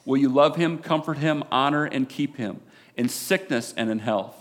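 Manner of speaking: loud, fervent wedding sermon; preaching. Real, not acted. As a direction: preach it with full conviction.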